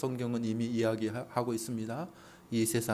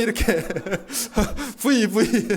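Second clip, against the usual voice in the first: laughing while saying V, V; 9 semitones higher, 7 dB louder, slower than usual. Real, not acted. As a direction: laugh through the words, pitched higher. (웃음 섞인 높은 목소리)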